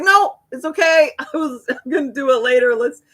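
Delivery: high, loud, flustered brush-off; squeaky hurried "no, it's fine" to a friend offering to help; nervous deflecting. Retold or imitated. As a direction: nervously wave friends away from the sink. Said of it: "I'd be like".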